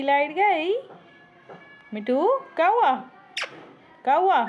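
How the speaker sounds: high cooing pet talk; sing-song to a pet. Pretend. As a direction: coo at the bird as if it were a baby. Soothing the parrot when the power cuts.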